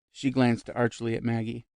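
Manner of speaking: ordinary delivery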